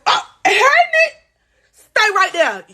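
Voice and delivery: high fake-friendly greeting, drawn out; mock sweet coworker. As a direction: imitate her chirpy brush-off. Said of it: "She like".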